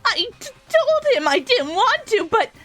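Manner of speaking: put-on high, stammering, wounded girl voice; stuttering upset Toko. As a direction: sob out the complaint in a high stammer. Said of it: "she's so upset".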